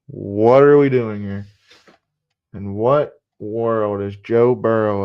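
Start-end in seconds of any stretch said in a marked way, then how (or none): 0.1-1.5 loud, slow, exasperated. Real, not acted. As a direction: throw the question out in disbelief. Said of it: "repeated louder, exasperated".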